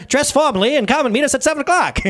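put-on high-pitched old-fashioned party invitation; hammed-up old-timey caller. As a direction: put on a voice from years ago. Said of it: "that's my old-timey voice".

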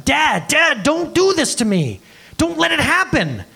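put-on high, pleading kid's voice; child begging. A kid begs their parent, high pleading voice. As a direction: beg your dad not to let it happen.

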